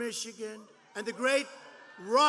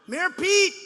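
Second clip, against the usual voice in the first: Mayor Pete! shouted high and loud; mock call-out; 8 semitones higher, 10 dB louder than usual. Rally speaker taunting a rival by nickname, loud mock shout. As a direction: bellow "Mayor Pete!" at the crowd.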